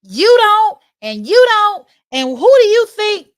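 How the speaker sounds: high, loud, furious mock yell; angry outburst. She imagines yelling at the adults, loud mock fury. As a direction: let rip at the neighbors in mock rage. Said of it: "I'm laughing because at the time, of course, I wanted to".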